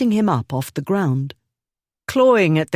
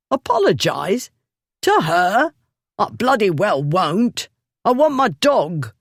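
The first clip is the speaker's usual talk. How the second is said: put-on higher, indignant squawk of a drunk; outraged bully; 6 semitones higher than usual. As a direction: bristle with disbelief. Drunk Bullard protests indignantly.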